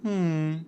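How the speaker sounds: soft, pointed hum; small unsatisfied hum; disapproving sigh. Acted. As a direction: sigh "hmm" quietly in mock disappointment. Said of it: "sighs that let her know".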